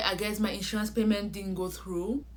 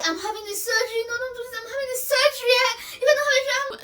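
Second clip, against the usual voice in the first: high, wailing, tearful plea; fake crying; 6 dB louder, faster than usual. Begging the insurance agent on the phone, hammed-up fake sobbing. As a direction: sob it out to win sympathy, hammed-up. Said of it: "I put on my best acting skills and I started crying".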